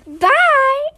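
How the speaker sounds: loud, high bye; cheery goodbye. Real, not acted. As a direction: call out goodbye brightly. Farewell to viewers.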